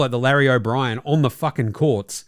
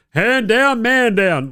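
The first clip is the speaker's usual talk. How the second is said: higher, louder mocking catchphrase; parroting a TV analyst's catchphrase; mock Mark Jackson; 7 semitones higher, 5 dB louder, much slower than usual. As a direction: ham up a pundit's empty slogan. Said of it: "Mark Jackson's fuckheadedry of".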